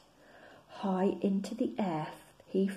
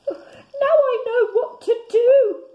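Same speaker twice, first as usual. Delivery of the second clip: much higher, louder, laughing; excited cry; 13 dB louder than usual. Acted. Bear cries out after spotting his star. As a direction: burst out with joy. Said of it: "he cried".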